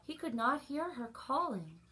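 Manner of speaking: ordinary delivery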